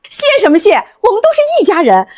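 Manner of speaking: high, eager, motherly warmth; 热情亲切. Acted. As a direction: brush off thanks joyfully. Mother waves off thanks, delighted. (热情高调地把对方当自家人)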